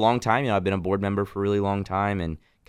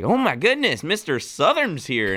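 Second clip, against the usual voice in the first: higher, wide-eyed mock exclaim, then slower; mock Californian gush; 8 semitones higher, slower than usual. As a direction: tease like a startled Californian spotting a Southerner. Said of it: "And they're like".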